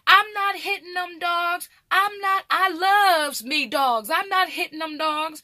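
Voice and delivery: high, loud imitation of an indignant grandma. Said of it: "she was like".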